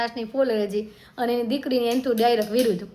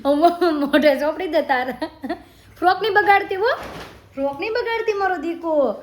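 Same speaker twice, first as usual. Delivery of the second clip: laughing scolding; 6 semitones higher than usual. Real, not acted. Mother laughing as her toddler smears food.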